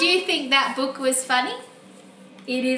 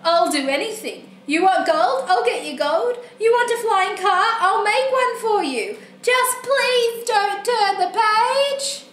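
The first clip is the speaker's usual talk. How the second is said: mock crying plea; 5 semitones higher, 4 dB louder than usual. Book character bargaining with the reader, mock-tearful begging. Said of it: "I'm crying".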